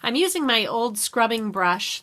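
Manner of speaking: normal talking voice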